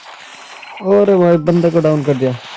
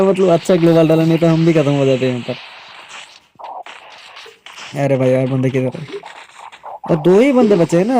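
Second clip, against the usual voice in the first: ordinary delivery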